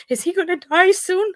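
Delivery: put-on high, anxious child's question; son's worried question. Son asks mom if grandpa will die, high and worried. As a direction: voice the scared son asking.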